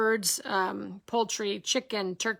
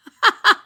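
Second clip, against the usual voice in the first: sudden high loud laugh; teacher laughing at a student's response; 11 semitones higher, 11 dB louder than usual. Real, not acted. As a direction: burst out laughing.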